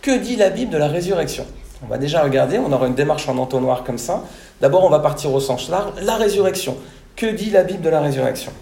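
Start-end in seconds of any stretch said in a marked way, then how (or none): none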